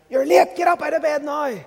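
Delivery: high, loud scolding mother; impatient shout. Retold or imitated. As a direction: yell them out of bed, annoyed. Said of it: "Rather than the kind of".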